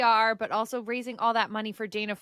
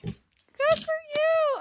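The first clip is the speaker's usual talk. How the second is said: high, drawn-out cheer; delighted congratulation; 4 dB louder, much slower than usual. Real, not acted. Host to guest who found her purpose, delighted cheer. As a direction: stretch it out, thrilled for them.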